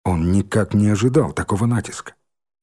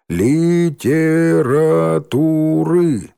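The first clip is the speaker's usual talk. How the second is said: slow syllable-by-syllable reading; 6 semitones higher, 4 dB louder, much slower than usual. A narrator's reading. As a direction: drag it out syllable by syllable. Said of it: "стали читать по складам".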